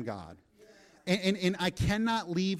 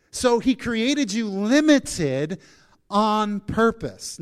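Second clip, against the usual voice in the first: full-force preaching; 5 dB louder than usual. Acted. Pastor driving the point home to the congregation, emphatic preaching. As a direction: build and hammer each line to the church.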